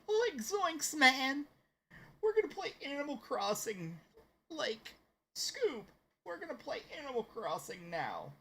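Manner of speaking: very high cartoon slacker voice; Shaggy. Streamer announces the game as Shaggy talking to Scoob, high cartoon impression. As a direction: talk to your dog buddy in a high-pitched cartoon voice. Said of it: "I'll just stream as Shaggy".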